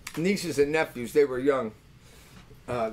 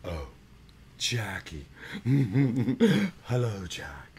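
special dog-talking voice; 3 semitones lower, slower than usual. Shown as a demonstration. Uncle showing kids how to greet the pit bull, low hushed croon. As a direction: drop low and nearly whisper to the dog. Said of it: "You have to talk like this to him".

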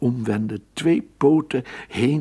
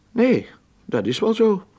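much higher, a little louder rat voice; water rat voice; 12 semitones higher, 3 dB louder than usual. Acted. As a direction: squeak the reply as the water rat. Water rat answers his guest.

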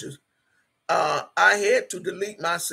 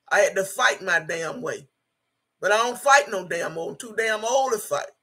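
ordinary talk, nothing marked

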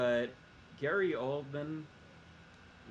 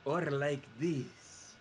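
slow, deliberate other voice; slow put-on voice; much slower than usual. Shown as a demonstration. Guest contrasts two ways of talking, slow put-on voice. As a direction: drag it out in a different voice, much slower. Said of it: "You're either talking like this".

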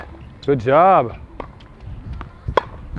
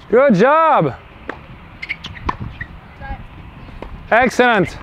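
excited praise; 8 semitones higher, much slower than usual. Real, not acted. Coach praising shots.

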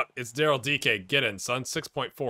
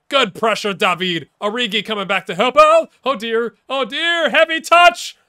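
higher and louder, climbing each line; rising alarm; 8 semitones higher, 11 dB louder than usual. Real, not acted. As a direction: cheer then panic as the touch goes heavy. Live commentary rising to alarm.

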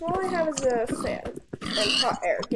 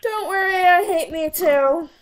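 mock self-pity; 5 semitones higher, 7 dB louder, slower than usual. Real, not acted. Self-deprecating joke.

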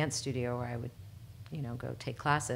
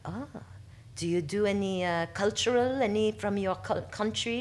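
a hammed-up mock French accent, pitched higher; terrible French accent; 5 semitones higher than usual. A French casting director asking the dancer, in a mock French accent. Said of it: "But I'm gonna keep going with it".

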